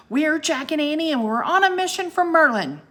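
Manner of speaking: high, loud, bold reply; shouting back. A narrator's reading. Annie answers the sea serpent, loud brave shout. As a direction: shout up at the monster without backing down. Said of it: "Annie shouted back at the monster".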